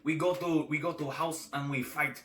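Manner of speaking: a hint of a younger man's Russian accent; lightly voiced Russian accent, broken English. Podcaster answers his cohost in a light Russian accent. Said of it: "I'm a younger Russian".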